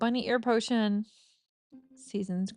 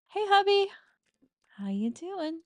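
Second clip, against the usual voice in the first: high, loud cheerful hello to the husband; excited greeting; 10 semitones higher, 3 dB louder than usual. Real, not acted. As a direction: call out happily to him. Greets her in-game husband.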